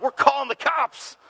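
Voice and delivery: put-on high childish threat; high mock-kid warning; kid threatening. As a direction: announce it like a bratty kid. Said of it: "and I'm like".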